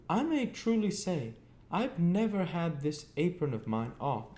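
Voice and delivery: put-on high, cross scolding of a boy; shrill-voiced sister scolding. A narrator's reading. Mrs Joe complaining at length.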